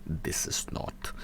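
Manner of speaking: hushed whisper; whispered aside. Real, not acted. Streamer muttering a verdict, whispered. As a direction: drop to a whisper.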